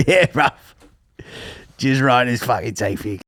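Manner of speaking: a lightly done South London lad; South London accent. Retold or imitated. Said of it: "South London accent".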